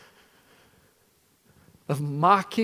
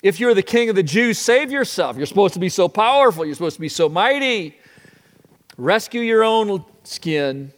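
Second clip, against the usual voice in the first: higher, louder jeering taunt; mocking soldiers; 7 semitones higher, 10 dB louder than usual. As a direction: sneer it like a cruel soldier. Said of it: "soldiers take their turn".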